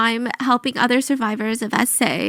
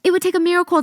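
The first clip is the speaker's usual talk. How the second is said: higher mock voice of doubting doctors; mocking doubters; 6 semitones higher, much faster than usual. Host mocking the doctors who doubted her, higher. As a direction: parody the skeptics, pitched up.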